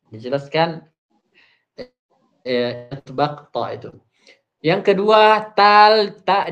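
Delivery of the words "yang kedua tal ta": loud announcement. Real, not acted. Loud new point.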